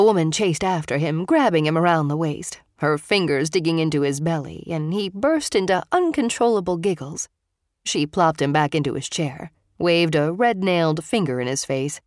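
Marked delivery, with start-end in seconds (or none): none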